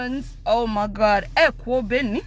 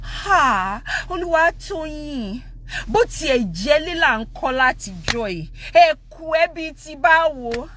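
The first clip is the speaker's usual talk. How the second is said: cutesy, demure mom; 4 semitones higher than usual. Retold or imitated. Mom gently reproaching dad, cutesy and demure. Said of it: "cutesy and demure".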